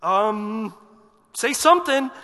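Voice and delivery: loud, high nudge to speak; the waiting people press the mute priest; impatient crowd. Retold or imitated. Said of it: "And they're like".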